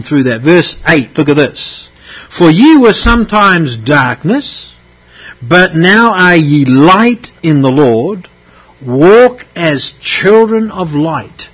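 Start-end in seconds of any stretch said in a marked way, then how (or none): 2.3-11.5 slow, solemn scripture read, a bit louder. Acted. Preacher reading the verse aloud to the congregation, slow and solemn. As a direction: lift the verse out slowly for the congregation.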